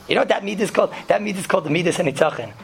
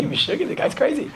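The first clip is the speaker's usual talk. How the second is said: mock scream; 9 semitones higher than usual. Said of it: "He's screaming away".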